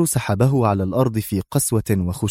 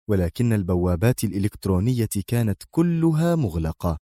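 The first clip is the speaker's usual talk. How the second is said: usual speaking voice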